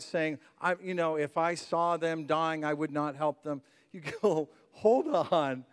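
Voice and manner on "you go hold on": usual speaking voice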